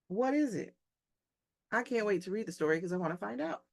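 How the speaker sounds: usual speaking voice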